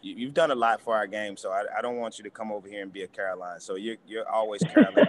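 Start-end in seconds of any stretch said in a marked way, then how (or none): 4.3-5.1 laughing. Real, not acted. Teasing the reporter about her name.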